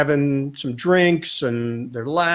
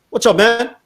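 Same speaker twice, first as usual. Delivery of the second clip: higher, punchy imitated greeting; hearty greeting; 8 semitones higher, 4 dB louder than usual. Star to broadcaster while exiting the train, hearty. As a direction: burst out with a buddy's hello.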